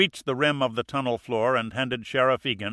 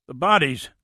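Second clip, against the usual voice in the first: higher, louder breathless gasp of "bodies"; 4 semitones higher, 5 dB louder than usual. A narrator's reading. Winded deputy blurting news to the sheriff, gasping "bodies". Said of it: "Charlie the deputy gasped".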